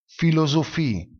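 filosofie con PH detta come F e IE lunga; pronuncia tedesca. Shown as a demonstration. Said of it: "si pronuncia". (filosofie, accento sull'ultima I)

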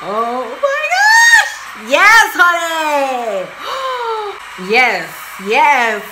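high, loud, drawn-out squeal of joy; ecstatic shout. Real, not acted. Fan screams at Tyra's grand entrance, ecstatic. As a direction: scream it slowly, overwhelmed with delight.

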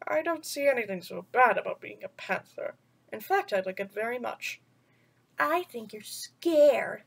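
put-on gentle storyteller voice reading to children; bedtime story reading. A narrator's reading. Vlogger reading a picture book to kids, storytelling voice.

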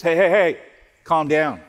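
a hammed-up calm-down from a friend; mock hushing. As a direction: imitate someone trying to calm you, a bit loud. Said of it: "somebody says".

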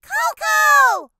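high, loud surprised cry; surprised shout. A narrator's reading. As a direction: cry out the name in shock. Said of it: "the twins exclaimed in surprise".

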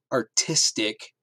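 "artistic" slow and weighted; emphasis on artistic. Acted. Mother to husband, stressing "artistic" slowly. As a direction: lean on "artistic" pointedly. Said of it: "placed just enough emphasis on the word".

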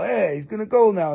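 ordinary talk

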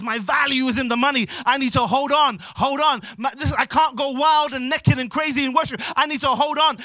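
loud, high acted protest; desperate insistence. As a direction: cry out as a man refusing to let go. Acted ruler clinging to his money.

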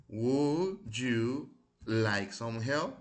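very slow, loud, each word drawn out for the class; slow, careful model reading. Shown as a demonstration. Teacher repeats for students, slow and loud. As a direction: spell it out slowly so students can copy. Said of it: "vamos a repetirlas".

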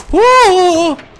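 very high, loud drawn-out whoa; long high yell of shock; startled whoa. Real, not acted. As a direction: yelp in alarm, holding the vowel. Startled during the assault.

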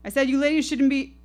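high, loud, menacing shouts; aggressive yelling. Acted. Drunk man yells threats at the silent women, high and loud. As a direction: bark the threats to scare them.

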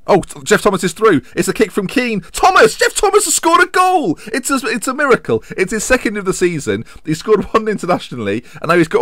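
high, loud commentator's outburst; real thrilled goal call, voice leaping up; excited goal commentary. Real, not acted. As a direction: build to a shout as the striker scores. Live commentary on a surprise goal.

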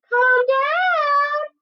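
very high, drawn-out piggy call; little pig. Acted. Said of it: "said Little Pig".

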